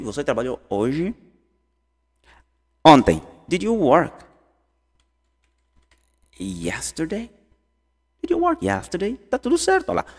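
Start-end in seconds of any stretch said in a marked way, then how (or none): 3.5-7.3 long pause before "yesterday"; broken into parts. Shown as a demonstration. Teacher splits question into parts. 8.2-9.2 high-pitched question in one flow; run together. Shown as a demonstration.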